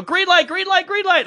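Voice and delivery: high, rapid-fire mock panic; retold panicked urging. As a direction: urge the driver on in a high squeal. Passenger urging driver to flee.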